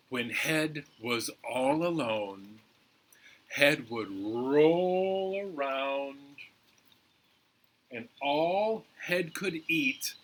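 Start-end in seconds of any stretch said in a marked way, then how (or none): 3.5-6.2 slow, stretched narration; drawn-out storytelling. A narrator's reading. Storyteller describing Head rolling around, drawn out.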